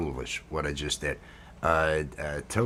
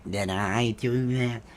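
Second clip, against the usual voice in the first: slow, nerdy Urkel catchphrase voice; 3 semitones higher, slower than usual. Retold or imitated. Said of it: "are making Urkel".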